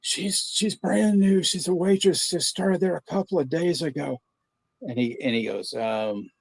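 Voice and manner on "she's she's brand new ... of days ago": higher, pleading husband voice; prank caller. Retold or imitated. Prank caller asking the pizza clerk for his wife, pitched-up worried husband. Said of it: "He's like".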